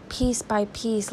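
normal talking voice

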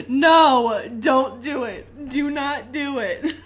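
loud, slow, laughing yell of no; screaming at the TV. Retold or imitated. Home alone, yelling at the movie with a loud laughing plea. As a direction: plead with the screen at full volume. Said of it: "just screaming at the T V".

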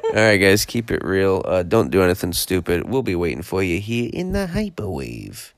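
loud, low host sign-off; show sign-off. Acted. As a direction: close out the show like a radio host. Signs off show.

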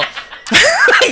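high, loud burst of laughter; shrieking laugh. Real, not acted. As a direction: crack up laughing. Big laugh.

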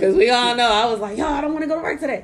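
laughing, loud and happy. Real, not acted. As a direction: laugh through the words. Laughing celebration.

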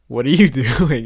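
amused puzzled question; laughing question. Retold or imitated. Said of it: "I was like".

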